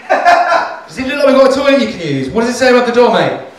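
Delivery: loud, high Geordie shop worker; angry Geordie accent. Retold or imitated. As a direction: snap back in a Geordie accent. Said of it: "I just like doing a Geordie accent".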